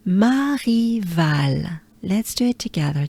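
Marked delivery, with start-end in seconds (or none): 0.1-1.8 Marival drawn out very slowly, French r. Shown as a demonstration. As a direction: stretch Marival slowly with a breathy r. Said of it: "So it's".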